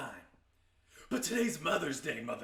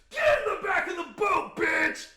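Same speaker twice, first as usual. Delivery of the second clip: much higher and louder hammed-up shout; mock shout; 11 dB louder than usual. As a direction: yell it like an enraged old man. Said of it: "he came out and he said".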